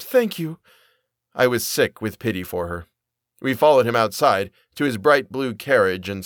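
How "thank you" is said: high, weepy gratitude; tearful. A narrator's reading. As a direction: weep the thanks in a high voice. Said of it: "Mother wept".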